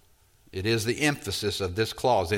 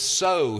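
"so" pitched high, a touch louder; stress on so; 7 semitones higher, 3 dB louder than usual. Shown as a demonstration. Explaining the verse, "so" lifted high. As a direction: ring out the word "so". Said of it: "because this".

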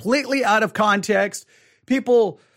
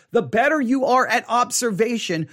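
ordinary talk

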